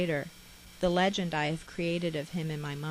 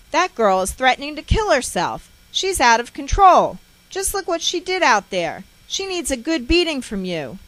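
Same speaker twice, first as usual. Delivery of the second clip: loud and higher, furious accusation; angry shouting; 8 semitones higher, 13 dB louder than usual. Retold or imitated. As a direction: yell at him over the girl. Mother arguing with father.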